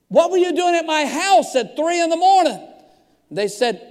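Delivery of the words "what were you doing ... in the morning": loud, high-pitched indignant demand. Retold or imitated. Pastor questions police about his house, indignant. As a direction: raise your voice in mock alarm.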